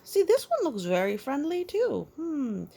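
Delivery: high, bright-eyed delight; lively excitement over a picture. Real, not acted. Excited to child.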